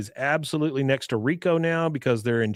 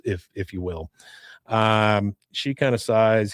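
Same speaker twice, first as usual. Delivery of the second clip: ordinary talk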